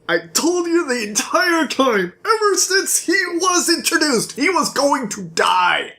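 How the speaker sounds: exasperated, climbing high; mock outburst. Reader scolding listeners about a doomed character, high mock outburst. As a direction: insist in a high, worked-up pitch.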